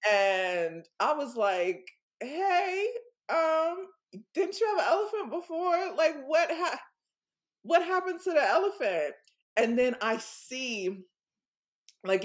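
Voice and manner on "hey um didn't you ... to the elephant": high, light questioning voice; a playful high-pitched question; high mock-innocent quote. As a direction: ask sweetly in a much higher voice. Quoting self to boyfriend, high voice.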